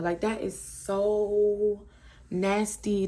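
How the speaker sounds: "so" stretched out very long before "Nasty"; slow, disgusted stretch on "so"; drawn-out disgust. Retold or imitated. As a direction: hold "so" for emphasis, pause, then "Nasty". Own disgusted reaction, retold.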